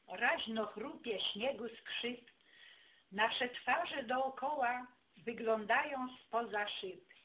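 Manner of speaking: usual speaking voice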